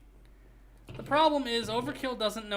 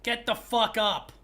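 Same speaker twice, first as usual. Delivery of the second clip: slow, louder repeat of the punchline; hammed-up echo of the friend's joke; mock repeat; 4 dB louder than usual. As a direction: savour the line slowly.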